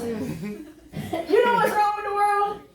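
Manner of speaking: each word stretched slow through a laugh; teasing slow replay of the dreaded opener; slow, drawn-out retelling. Retold or imitated. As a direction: drag the line out slowly, laughing at yourself. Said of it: "she's trapped in the truck".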